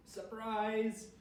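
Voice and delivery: excited shout; shouted surprise. Acted. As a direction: call it out like Dad opening the door. Dad shouts in story.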